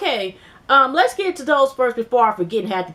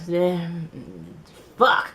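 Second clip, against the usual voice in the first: unimpressed dismay; 4 semitones lower, 4 dB quieter than usual. Retold or imitated. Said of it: "looked at it like this".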